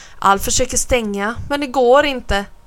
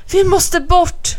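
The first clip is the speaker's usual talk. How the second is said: higher, louder, pressing; urgent alarm; 8 semitones higher, 5 dB louder, slower than usual. A narrator's reading. Alf urges Bo away from the water, urgent alarm. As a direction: urge a friend to flee. Boys must flee rising water.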